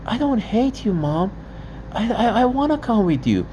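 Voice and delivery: put-on high childlike plea; mild imitation of a child begging; child pleading. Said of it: "my child was telling her".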